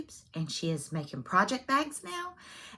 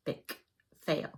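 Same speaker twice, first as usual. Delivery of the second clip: much softer, muttered repeat to herself; quiet echo; 4 semitones lower, 6 dB quieter than usual. Shown as a demonstration. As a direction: echo it under your breath. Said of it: "and I am an epic fail".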